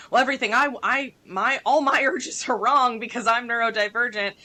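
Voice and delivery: high, loud mock-defensive whine. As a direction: whine it like you're offended. Mocking defensive self-talk.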